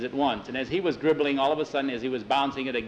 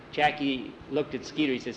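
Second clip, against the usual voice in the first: ordinary delivery, nothing marked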